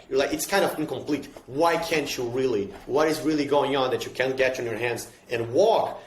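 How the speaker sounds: ordinary talk, nothing marked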